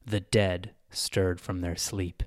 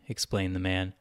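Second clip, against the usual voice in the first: ordinary delivery, nothing marked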